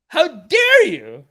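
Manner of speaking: slow, loud 'How dare you?' falling to a firm end; mock outrage. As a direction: land 'dare' hard and let it drop.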